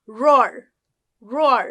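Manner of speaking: রোয়ার with a loud, heavy American R at the end; strong American R. Shown as a demonstration. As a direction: growl out the R in রোয়ার. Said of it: "খুব জোর দিয়ে".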